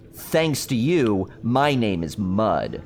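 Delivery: slow, drawn-out joke delivery; retelling mother's joke at a slow deadpan pace; deadpan punchline. Retold or imitated. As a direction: let the punchline land slowly. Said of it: "what did the dirt say to the rain".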